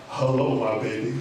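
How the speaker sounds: very low man on the phone; deep voice. Retold or imitated. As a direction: drop way down for an estranged dad's hello. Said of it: "I just hear a deep voice".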